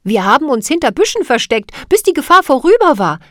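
put-on young girl's voice. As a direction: hurry through the scary memory as a girl. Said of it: "sagte Cathy".

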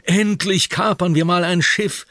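hoch und laut, voller Vorfreude; aufgeregt. Acted. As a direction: jubelt über die Beute. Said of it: "schreit Oliver aufgeregt". (Oliver freut sich auf den Schatz, aufgeregt laut)

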